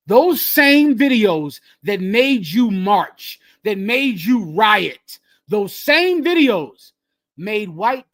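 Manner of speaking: loud, slow, drawn-out emphasis; impassioned outcry. Real, not acted. Host hammering his point about the same videos, impassioned outcry. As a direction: raise it and stretch each word like a preacher.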